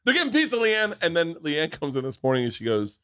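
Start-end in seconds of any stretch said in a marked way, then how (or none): 0.1-1.0 excited. Retold or imitated. Drunk dad watching daughter on app.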